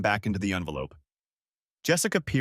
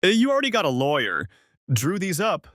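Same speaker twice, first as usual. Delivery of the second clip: angry; 7 semitones higher, 5 dB louder than usual. Acted. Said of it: "a flicker of anger in her eyes".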